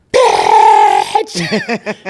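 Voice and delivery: loud, raw shouted word; full shout. Shown as a demonstration. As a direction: blurt it out as loud as you can. Acting class shout exercise.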